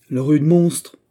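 lively exclamation. A narrator's reading. Christine jumps up at mention of the king, lively outcry. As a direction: cry out with sudden vivacity. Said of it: "S'écria Christine en se levant avec vivacité".

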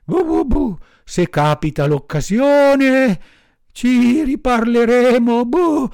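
high, loud dog voice, thanks broken by barks; high loud barking thanks; grateful barking dog. A narrator's reading. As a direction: stammer thanks between barks. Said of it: "rispose il cane".

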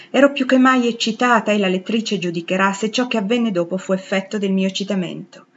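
usual speaking voice

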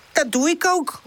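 high, defiant retort; defiant call. A narrator's reading. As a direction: call it back stubbornly. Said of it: "roept Woezel".

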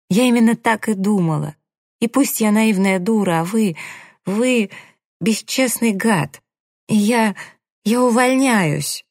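high-pitched indignant tirade; angry outburst. A narrator's reading. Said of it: "заорала она".

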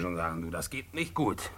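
hushed warning to his wife. Acted. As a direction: drop to a near murmur. Lowered voice about neighbour.

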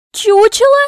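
very high, very loud echo; explosive high-pitched question; outraged shriek. Acted. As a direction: shriek it in indignant disbelief. Princess outraged, repeats the insult.

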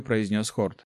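usual speaking voice

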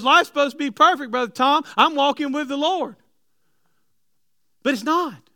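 loud, high preaching climb; preaching at full force. Acted. Preacher loudly telling the church life is not perfect. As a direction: press it hard on the church.

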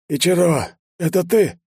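high, strained questioning voice; dying chief. A narrator's reading. Dying chief recognises the hero, high strained voice. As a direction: reach out weakly for a familiar person.

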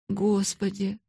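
understated pitying dismay; a touch of plaintive shock. A narrator's reading. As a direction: say it as a small lament. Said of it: "жалобно проговорила Эрмингарда".

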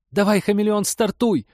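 loud, high, frantic yell; panicked shout. Acted. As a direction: scream the order in panic. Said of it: "Мия сорвалась на крик".

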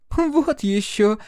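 high, drawn-out disdain; scornful huff. A narrator's reading. Chloe scorns the idea of paying a blackmailer, scornful huff. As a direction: scoff with the nose in the air. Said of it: "Хлоя фыркнула".

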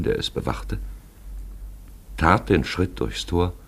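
ordinary delivery

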